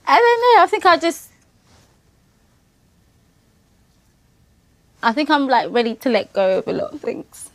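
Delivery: high, wavering tearful voice; talking through real sobs; crying. Real, not acted. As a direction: voice breaking high as she cries. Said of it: "I'm crying".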